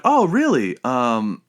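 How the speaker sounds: high-pitched imitation of a woman caller; lightly mimicked female telemarketer. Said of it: "She was like".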